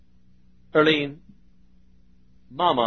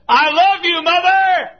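shouted high and much louder, as down a phone line; loud call; 12 dB louder, slower than usual. Retold or imitated. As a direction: call out with all your voice to a dying mother. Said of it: "I said them loud".

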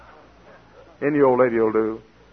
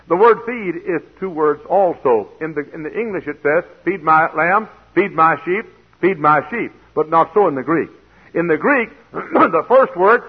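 rising sermon emphasis; 7 semitones higher, 8 dB louder than usual. Real, not acted. Preacher building on the word.

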